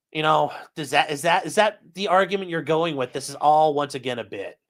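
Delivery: ordinary talk, nothing marked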